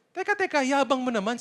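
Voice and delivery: high, sudden mock outrage; mock scolder. Preacher voicing Elihu cutting Job off, high and scornful. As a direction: jump in with a sneering stop.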